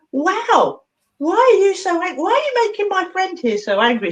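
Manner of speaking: high, playful astonished questioning; high-pitched mock confrontation; talking to her hand. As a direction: scold an imaginary little creature in wonder. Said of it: "I'm talking to my hand".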